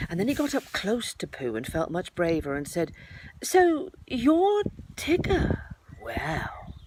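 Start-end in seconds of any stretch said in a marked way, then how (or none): 3.4-6.7 slow, offhand Piglet bravado; careless voice. A narrator's reading. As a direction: act unimpressed though small. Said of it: "in a careless sort of voice".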